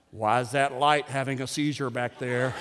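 low, soft complaint; mock disgruntled churchgoer. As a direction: grumble under the music like a killjoy. Acted grumbling churchgoer at worship.

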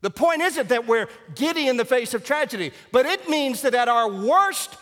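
loud, high, exultant pulpit cry; full-force preaching. Real, not acted. Pastor exhorting Baptists over the risen Christ, full-force preaching.

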